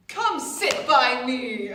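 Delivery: drawn-out, booming invitation; loud punchline. Acted. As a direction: land the gossipy punchline big and slow. Punchline of her saying.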